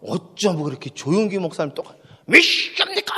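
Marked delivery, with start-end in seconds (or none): 2.3-3.1 put-on Gyeongsang accent. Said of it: "경상도 사투리로".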